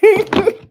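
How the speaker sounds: sudden high bright laugh; real burst of laughter at a viewer's joke in the chat. Real, not acted.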